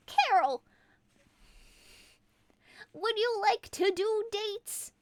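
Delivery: elf-man asking Carol on dates, high and eager. Acted. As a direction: ask her out in a high squeaky elf voice.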